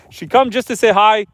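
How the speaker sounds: real laugh over a goat saying hello. Real, not acted. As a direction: chuckle while telling it. Goat comes up for scratches.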